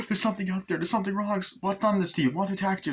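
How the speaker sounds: high, imitated anxious voice; mock fright. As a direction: insist anxiously like a horror character. Acts frightened exchange about the attack.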